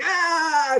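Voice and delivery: high piercing cry of "Ah"; loud shout. Retold or imitated. Nimai shouts to stop Murari telling his father, loudly. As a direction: yell to drown out the talk. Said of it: "Nimai starts shouting".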